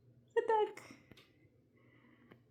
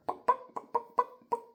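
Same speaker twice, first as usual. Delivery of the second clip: breathy bawks, getting louder; chicken bawking; 4 dB louder than usual. Shown as a demonstration. Teacher showing the other chicken sound, bawking. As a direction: bawk like a chicken.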